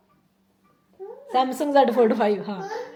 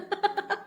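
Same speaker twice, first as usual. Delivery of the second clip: soft giggle; 9 semitones higher, 5 dB quieter than usual. Real, not acted. Laugh before the story.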